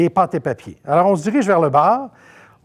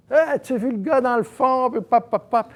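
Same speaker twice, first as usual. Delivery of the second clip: higher, nudging gossip voice; gossipy excitement; 7 semitones higher than usual. Retold or imitated. Concierges whispering about the boss, excited high gossip. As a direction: nudge your buddy about the guy in back.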